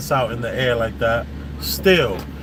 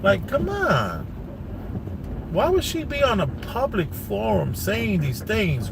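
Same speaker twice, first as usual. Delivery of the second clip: exasperated disbelief; 5 semitones higher than usual. Real, not acted. Vlogger despairs at her public comments, exasperated. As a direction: plead in disbelief, pitch swooping high.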